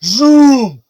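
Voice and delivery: long, loud, high "zoom"; zooming whoosh. Acted. Game master showing the orc's fist passing Jesse, loud zooming sound. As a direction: vocalize a fist flying past. Imitated noise of thrown punch.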